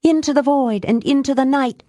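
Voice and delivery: high, intoned spell casting; solemn high-pitched incantation; ritual incantation. A narrator's reading. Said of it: "Nell continued".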